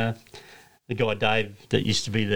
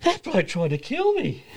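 higher and slow, imitating a spooked driver; mock frightened [name]; 10 semitones higher, slower than usual. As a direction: drag it out like a shaken mate. [name] retells being scared.

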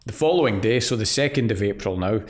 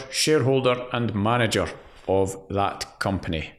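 ordinary delivery

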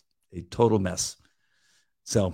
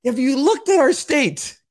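incredulous rant; 13 semitones higher than usual. Retold or imitated. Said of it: "And you go".

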